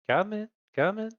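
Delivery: high welcoming host voice; creepy butler. Acted. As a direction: rise up on come in. Gordio welcomes party in.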